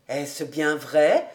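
low, slow, hopeful question; pleased doubting master. Acted. Flattered master asking housekeeper if it is true, low and slow.

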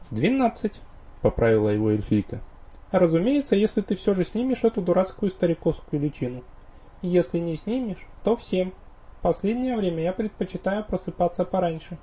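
high, teasing elf woman; playful elf wife. Acted. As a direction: correct him in a high elf voice.

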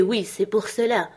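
ordinary delivery, nothing marked

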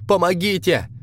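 high frightened shriek; scared cry for help. Acted. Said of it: "вскрикивал".

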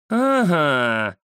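drawn-out cry starting high; menacing drawl. A narrator's reading. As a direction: stretch it out as an angry cat. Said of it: "протянула кошка, гневно сверкнув глазами". (угрожающе, протяжно, как сердитая кошка)

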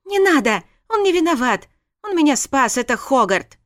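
frantic wife stopping her furious husband; pleading shout. Acted. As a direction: stop him, yell over him.

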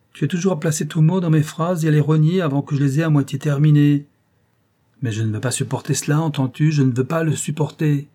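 the husband snaps back at his wife, angry. Acted.

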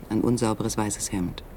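ordinary talk, nothing marked

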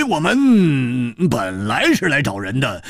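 slow, falling, stretched words; slow sly drawl. Acted. 蝴蝶花 slowly explains their visit, feigning innocence. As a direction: draw it out innocently.

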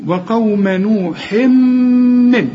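very slow, high وقوم نوح من, long hummed m; closed-lip ghunna. Shown as a demonstration. Teacher wants students to hear it, closed-lip ghunna. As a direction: close the lips and hold the m between نوح and من. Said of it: "اقفل شفايفك هنا واسمعها".

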